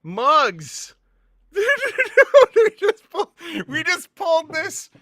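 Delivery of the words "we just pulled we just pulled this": high giddy laugh breaking into words; laughing in disbelief. Real, not acted. Laughing at the rare pull.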